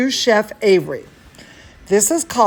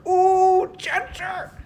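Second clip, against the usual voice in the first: tender; 11 semitones higher, slower than usual. Real, not acted. Hugging the dog fondly.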